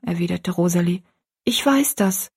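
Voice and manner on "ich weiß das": put-on higher-pitched voice of a cowed girl; meek girl. A narrator's reading. Rosalie giving in to her stepmother, meek and higher. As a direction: answer meekly in a raised girl's voice.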